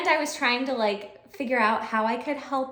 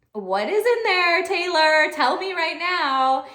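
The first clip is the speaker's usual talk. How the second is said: higher, louder, slow tell me now; mock demanding; 5 semitones higher, 4 dB louder, slower than usual. Teacher demanding to know what is in the sleeve, mock stern. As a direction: insist like a curious teacher.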